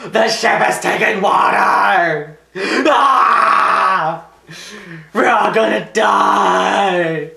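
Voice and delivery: hammed-up hysterical shouting at a stubborn man; mock-crazed yell, every word drawn out; going nuts. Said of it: "been like this".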